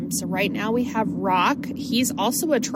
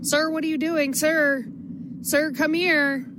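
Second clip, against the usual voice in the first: high calling voice, coaxing the dog; raised pitch, slowed, calling out to Sir; 7 semitones higher than usual. Retold or imitated. Owner calling an old blind dog in the store, high coaxing call.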